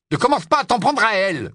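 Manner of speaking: loud, high angry warning; heated, forceful rebuke; angry scolding. A narrator's reading. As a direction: round on him in her defence. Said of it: "gronda Ron".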